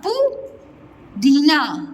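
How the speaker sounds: पुदीना drawn out slowly; पुदीना, each syllable long; slow stretched. Shown as a demonstration. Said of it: "पुदीना नहीं बोलते".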